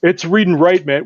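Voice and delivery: high, loud, insistent protest; agitated movie argument. Acted. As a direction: insist on it in a panicked soldier's quote. Acts out film argument over tracker.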